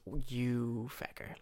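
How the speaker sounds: slow, soft, stunned curse; shocked Coleman. Retold or imitated. Coleman cursing his brother, slow and soft. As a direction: let it sink in slowly, stung.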